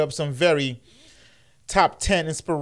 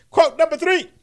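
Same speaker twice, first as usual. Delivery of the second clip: announcer bark; 13 semitones higher, 5 dB louder than usual. Acted. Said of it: "announcing quotes".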